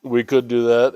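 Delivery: loud, slow, cocky boast. Acted. Mock boast about survival show.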